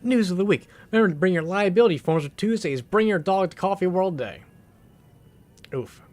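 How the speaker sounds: reading the weekly staff news like a chipper announcer. Acted. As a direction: read it out like a cheery office announcement. Reads in-game news bulletin aloud.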